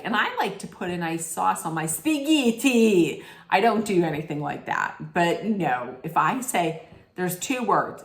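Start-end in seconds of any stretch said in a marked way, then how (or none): 0.0-3.2 hammed-up Italian accent on "spaghetti"; mock a TV chef's showy Italian accent; exaggerated Italian pronunciation, mocking; sudden Italian accent. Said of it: "all of a sudden she'll be like".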